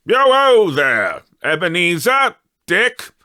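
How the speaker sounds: put-on loud, high, hearty call of a fat jolly old man; jovial old employer calling out. A narrator's reading. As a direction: boom it out rich and jolly, calling the lads. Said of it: "called out in a comfortable, oily, rich, fat, jovial voice".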